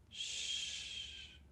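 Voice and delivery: soft unvoiced hush; long soft shush. Real, not acted. Rabbi hushes the congregation before the Shema, soft shush. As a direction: hush the whole room gently. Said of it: "allow your heart to be quiet".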